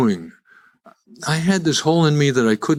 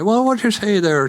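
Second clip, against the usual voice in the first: mock Irish accent, pitched well higher; 9 semitones higher than usual. Polish guy faking an Irish accent in a Chicago bar, hammed up. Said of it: "And I'd get an Irish accent".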